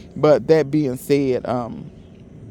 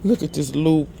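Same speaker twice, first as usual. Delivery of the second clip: usual speaking voice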